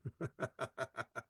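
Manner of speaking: quiet embarrassed laugh, falling away; soft laugh at himself. Real, not acted. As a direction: chuckle at your own slip. Laughs at his own mistake.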